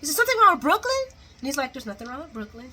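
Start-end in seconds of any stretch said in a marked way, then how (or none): 0.2-1.0 high, loud put-on questioning voice; hammed-up worry, pitched way up; mock concerned woman. Woman asking Chase about Brooklyn, high mock alarm. 1.7-2.7 softer, understated denial; mock dismissive reply. Chase brushing off the question, quiet mock denial.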